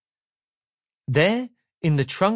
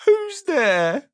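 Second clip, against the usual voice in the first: higher and louder, sad and squeaky; louder squeaky call; 13 semitones higher, 4 dB louder than usual. Acted. Mister Miserable calls again through the door, squeaky and louder. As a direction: call it again, more insistent. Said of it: "a sad, squeaky sort of voice".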